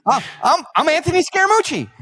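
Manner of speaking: high, loud, fast mock introduction; mimicking Scaramucci. Guest introducing himself, hammed-up high mock voice. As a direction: squeak out your own name indignantly. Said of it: "And he goes".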